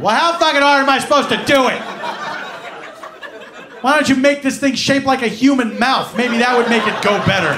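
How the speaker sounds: loud, fast, fed-up ranting; exasperated rant. Retold or imitated. Patient ranting at the x-ray tech, loud and fast. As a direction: fire the complaints back, fast and annoyed.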